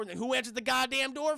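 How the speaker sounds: ordinary talk, nothing marked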